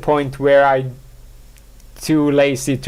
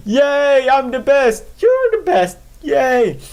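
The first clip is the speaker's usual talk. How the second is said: self-cheering; 10 semitones higher, slower than usual. Pretend. Podcaster cheering himself on, very high and gushing. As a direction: cheer yourself on like a fan crowd. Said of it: "self-motivation stuff".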